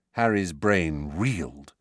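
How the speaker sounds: usual speaking voice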